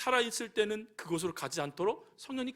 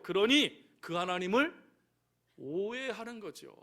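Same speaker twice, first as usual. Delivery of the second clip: normal talking voice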